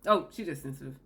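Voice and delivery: low, scoffing brush-off; shrugging off her feelings; dismissive. Retold or imitated. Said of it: "dismissive quote".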